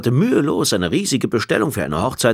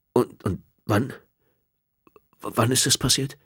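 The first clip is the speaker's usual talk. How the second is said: stammering shock; 4 semitones higher than usual. A narrator's reading. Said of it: "heraus kam nur ein Flüstern".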